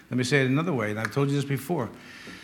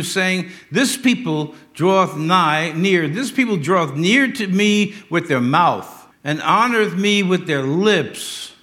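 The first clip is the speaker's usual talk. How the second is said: slow, raised scripture reading; 4 semitones higher, 4 dB louder, slower than usual. Acted. Preacher reading the rebuke of the hypocrites aloud, slow and raised. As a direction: read out the rebuke slowly, high and loud.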